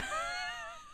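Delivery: short, high, burst-out giggle; real giggle, up high; high laugh. Real, not acted. Mother laughs at daughter.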